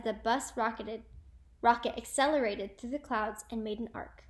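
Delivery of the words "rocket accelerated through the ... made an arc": ordinary delivery, nothing marked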